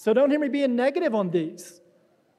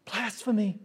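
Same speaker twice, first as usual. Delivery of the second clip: muttered thought; 2 semitones lower, 5 dB quieter than usual. Retold or imitated. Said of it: "all thinking".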